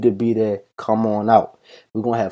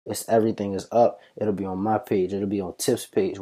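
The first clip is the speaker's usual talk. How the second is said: ordinary talk, nothing marked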